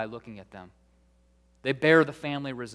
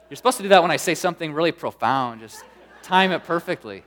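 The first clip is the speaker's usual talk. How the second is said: playful teasing; 4 semitones higher, 8 dB louder than usual. Real, not acted. Teasing congregation after a joke.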